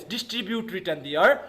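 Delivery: usual speaking voice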